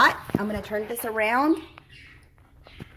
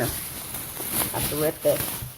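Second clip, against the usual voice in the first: low, soft, half-whispered aside to herself; muttered aside; 7 semitones lower, 8 dB quieter, slower than usual. Real, not acted. Cook mutters to herself tearing a packet open, soft and low. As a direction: mutter it under your breath while tearing.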